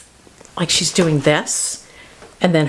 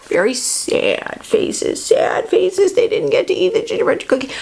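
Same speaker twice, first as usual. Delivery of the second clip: mock sad; 6 semitones higher than usual. Pitying sad faces.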